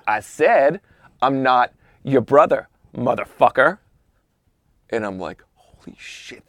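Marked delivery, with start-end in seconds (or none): 0.1-3.8 firm, full-voiced and deliberate challenge; confrontational repeat. Golfer nose to nose with O J, deliberate challenge. 5.5-6.5 hushed, breath-only exclamation; awed whisper. Retold or imitated. Golfer watching the standoff begin, awed whisper. Onlooker's secret glee at standoff.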